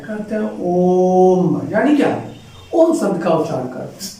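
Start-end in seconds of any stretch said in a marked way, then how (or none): 0.6-1.6 ओम held very long; prolonged vowel. Shown as a demonstration. Teacher showing a prolonged vowel, ओम stretched slowly. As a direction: draw out ओम like a chant, slowly.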